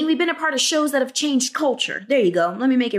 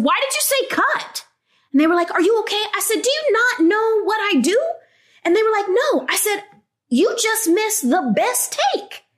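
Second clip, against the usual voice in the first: indignant retort; 10 semitones higher than usual. Retold or imitated. Arguing with the director on set.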